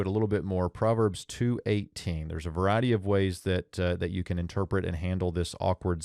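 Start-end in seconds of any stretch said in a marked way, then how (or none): none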